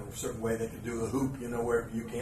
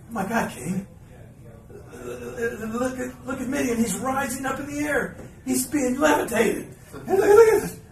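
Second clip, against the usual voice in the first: much higher, louder, amazed play-by-play voice; excited commentator; 12 semitones higher, 7 dB louder than usual. Retold or imitated. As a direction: mimic a commentator losing his mind over a levitation. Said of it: "all of a sudden Michael Cole goes".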